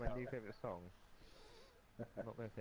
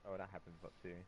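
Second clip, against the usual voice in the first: ordinary delivery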